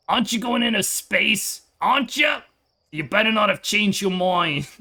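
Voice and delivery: badly faked Jersey accent; attempted Jersey accent. Streamer after Sopranos clips voicing a character, bad Jersey accent. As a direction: put on a Sopranos-style Jersey voice. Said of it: "reading everything with a Jersey accent".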